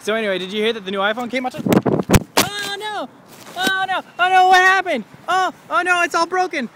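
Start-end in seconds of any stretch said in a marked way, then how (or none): 1.5-6.7 high, loud hammed-up panic; high, loud mock alarm over the phone. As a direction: freak out over the broken phone.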